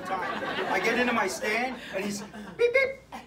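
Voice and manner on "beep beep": high, piercing double beep; shrill mouth-made beep-beep; imitated walkie-talkie beep. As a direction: mimic a radio call tone in two squeaky chirps. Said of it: "this thing fucking beeps every time".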